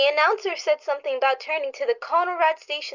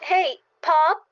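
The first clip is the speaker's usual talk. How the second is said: urgent call across the house; 5 semitones higher, 4 dB louder, much slower than usual. Acted. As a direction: shout for her father. Daughter calls father urgently.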